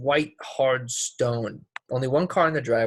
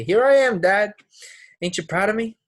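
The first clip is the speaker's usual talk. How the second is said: defiant call to dad; 8 semitones higher, 6 dB louder than usual. Retold or imitated. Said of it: "I wanted to march up to him and say".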